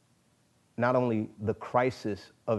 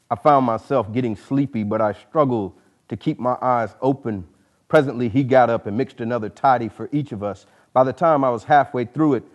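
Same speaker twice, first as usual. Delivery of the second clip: full, projected reading voice; story narration; 9 dB louder than usual. A narrator's reading. Author reading his novel's opening scene to an audience, projected narration. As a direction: read the scene aloud with weight.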